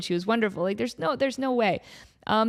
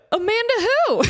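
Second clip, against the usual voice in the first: high, louder gasping disbelief; shocked gasp; 12 semitones higher, 5 dB louder than usual. Retold or imitated. Starstruck kid hearing her idol's name, gasping. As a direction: gasp like you can't believe it. Retold shocked fan on speakerphone.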